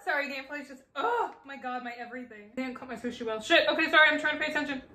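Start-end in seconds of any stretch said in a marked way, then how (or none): none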